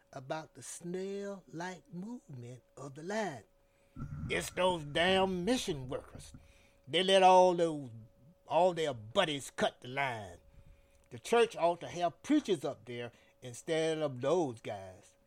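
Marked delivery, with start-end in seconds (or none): 4.3-15.1 grumbling complaint. A narrator's reading. Annoyed man complaining about line.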